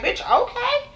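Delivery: high, breathy sassy okay; sassy mock okay. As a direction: vlogger acting out her reaction, sassy and high. Host mimicking her sassy reaction.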